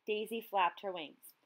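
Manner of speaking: plain reading voice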